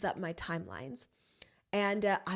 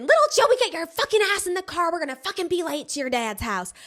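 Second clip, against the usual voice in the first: shrieking high and loud with a Southern twang; screaming church mom; 7 semitones higher, 8 dB louder than usual. Retold or imitated. Churchgoing mom screams at her son to get in the car, shrieking. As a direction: yell at the kid like a furious Southern mother. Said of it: "she's, like, screaming".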